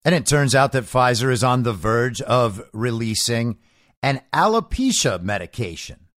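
ordinary talk

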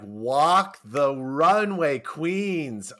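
loud, drawn-out hype; slow, emphatic runway cheer; admiring cheer. Retold or imitated. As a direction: cheer them on, stretching each word. Cheering on the glamorous ladies.

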